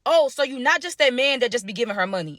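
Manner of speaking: usual speaking voice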